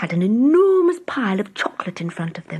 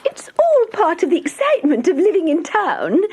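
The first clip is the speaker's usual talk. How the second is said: chuckling; 10 semitones higher, 4 dB louder than usual. Acted. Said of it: "chuckled the Town Mouse".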